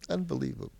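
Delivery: normal talking voice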